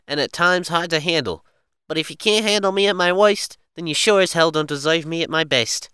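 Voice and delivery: high, loud annoying Italian gang character; annoying gangster. Acted. Reading a quote to a co-host, as an annoying gang member. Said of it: "trying to be, like, the really annoying guy".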